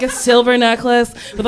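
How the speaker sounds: ordinary talk, nothing marked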